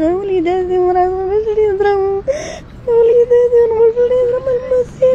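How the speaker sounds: high tearful voice breaking into sobs; crying. Real, not acted. Mother crying about her children. (بكاء حقيقي بصوت عالي)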